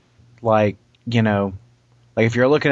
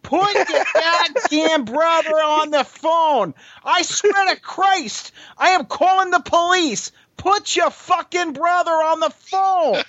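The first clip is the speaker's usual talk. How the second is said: much higher, slow mock scolding voice; payphone woman impression; slower than usual. Retelling a woman yelling into a payphone, high slow mock voice. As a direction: imitate the fed-up woman barking orders, pitched way up and drawn out. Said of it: "And she's like".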